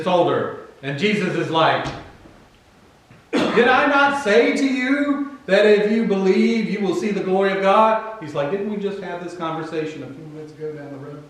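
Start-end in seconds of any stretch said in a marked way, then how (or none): 3.3-8.2 high, emphatic rhetorical question; mock exasperation. Jesus reminding Martha at the tomb, mock exasperation. 8.5-11.2 quick, quiet incredulous aside; muttered reminder. Acted. Jesus reminding Martha of their talk, muttered aside.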